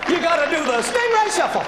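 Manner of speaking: high, bright-toned announcer calling swimmers in; mock beach lifeguard. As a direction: pitch it way up like a chirpy lifeguard.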